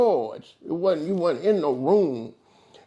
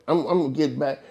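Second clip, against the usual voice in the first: usual speaking voice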